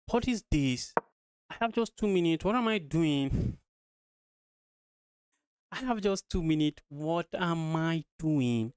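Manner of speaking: high-pitched, drawn-out dismay at the clock; panicked exclamation. Real, not acted. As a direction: cry out in alarm as time runs out. Panics with two minutes left.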